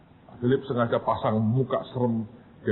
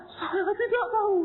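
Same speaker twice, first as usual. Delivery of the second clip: high, sobbing cry of innocence; crying denial. Retold or imitated. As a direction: wail that you never killed anyone. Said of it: "nangis, nyembah-nyembah". (mahasiswa menangis keras menyangkal membunuh)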